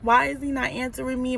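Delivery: retold sniffling distress. Girlfriend retelling her unanswered calls, mildly upset. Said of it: "I'm, like, sniffling and stuff".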